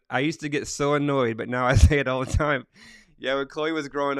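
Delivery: loud, amused read-out breaking into laughter; tickled, laughing voice; real laughing. Real, not acted. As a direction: laugh while reading the comment aloud. Laughs reading out Chloe's comment.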